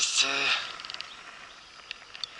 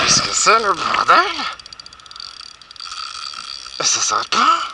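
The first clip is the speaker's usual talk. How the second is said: surexcité; 7 semitones higher, 7 dB louder than usual. Real, not acted. Le poisson tire sans arrêt.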